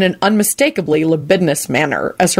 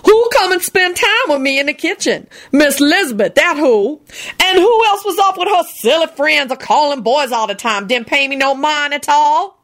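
much higher, indignant; scolding Lucretia; 9 semitones higher than usual. A narrator's reading. Lucretia scolding Solange, much higher. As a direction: rant high and indignant.

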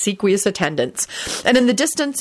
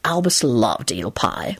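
low, slow, fond recollection; reminiscent; 7 semitones lower, slower than usual. A narrator's reading. Man recalling Albus at table, reminiscent. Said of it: "Lupin said reminiscently".

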